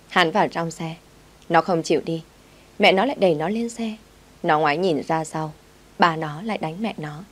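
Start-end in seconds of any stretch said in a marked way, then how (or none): none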